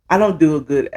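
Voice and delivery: ordinary delivery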